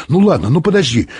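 plain reading voice

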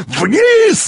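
very high, drawn-out yell; urgent high-pitched holler. A narrator's reading. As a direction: bellow the order at a high pitch. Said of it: "заорал он".

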